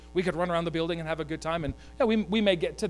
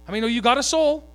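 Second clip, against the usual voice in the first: higher and louder, fast rallying question; rousing call; 6 semitones higher, 7 dB louder, faster than usual. Real, not acted. Preacher rallies the congregation, fast and loud. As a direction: fire up the congregation.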